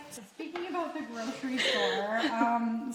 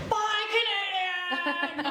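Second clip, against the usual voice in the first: put-on higher, slow drunk cheer; mock drunken yell; 8 semitones higher, much slower than usual. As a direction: imitate a tipsy dad bellowing at a concert. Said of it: "he was literally yelling".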